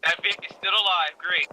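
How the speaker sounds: loud urgent radio call; shouted callout. Real, not acted. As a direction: shout it over the gunfire. Combat callout over gunfire.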